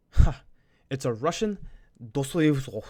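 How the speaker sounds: loud, hammed-up Russian accent; mock Russian accent. Student imitating a kid naming his instrument, bad Russian accent. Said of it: "Sorry, I don't know Russian accents".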